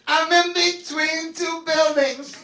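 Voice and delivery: lightly sung dippy reply; sing-song drunk. Retold or imitated. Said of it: "she goes".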